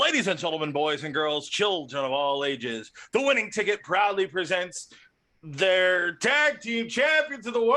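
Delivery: grand arena announcer introducing the champions; ringmaster. Acted. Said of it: "I've always wanted to do that".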